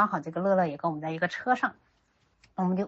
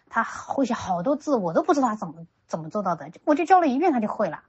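enthusiastic praise; 4 semitones higher, 6 dB louder than usual. Retold or imitated. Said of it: "我当时就立刻说".

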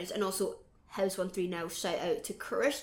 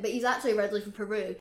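ordinary delivery

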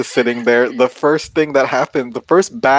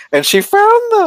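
higher and louder, thrilled; excited exclaim; 6 dB louder than usual. Real, not acted. Telling her friend the climax, thrilled. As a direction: burst out with delight.

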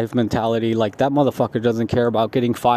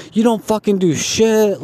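higher-pitched mock yell at a lazy coworker; retold yelling; 7 semitones higher than usual. Coworker yelling at the lazy guy, higher mock yell. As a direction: rip into him, voice pushed up high. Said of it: "like, yelling".

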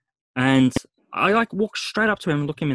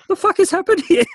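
loud, very high mock disbelief; bewildered outburst; 8 dB louder than usual. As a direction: blurt it out in hammed-up bafflement, voice shooting up. Retelling bewildered reaction, laughing after.

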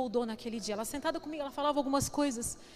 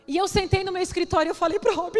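normal talking voice

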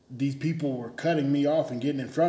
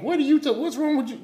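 higher, fast, exasperated yell; irritated outburst; 12 semitones higher, 3 dB louder, faster than usual. Retold or imitated. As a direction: throw it at the other driver like a fed-up rebuke. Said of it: "I was like".

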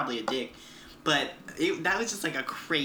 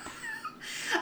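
squealing laugh; 8 dB quieter than usual. Real, not acted. Laughing at the brain surgery quote.

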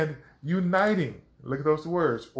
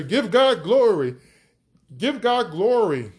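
raised, louder worship leader's call; 5 semitones higher, 4 dB louder than usual. Retold or imitated. Preacher imitating a worship leader in church, rallying call. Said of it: "the worship leader say".

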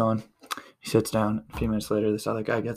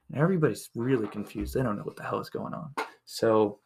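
ordinary talk, nothing marked

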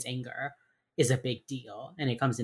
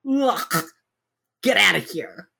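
much higher, louder mock-exasperated ugh; dismissive brush-off; 6 semitones higher, 6 dB louder, slower than usual. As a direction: wave her off in a playful imitation. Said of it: "he gives her this look like".

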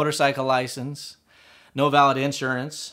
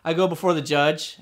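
ordinary talk, nothing marked

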